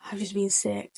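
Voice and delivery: very soft, low-key mimicry of a queasy friend; retold in a small, quiet voice; sheepish confession. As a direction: admit it under your voice. Quoting friend who just vomited.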